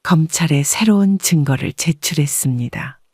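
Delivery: plain reading voice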